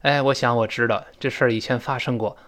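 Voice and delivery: normal talking voice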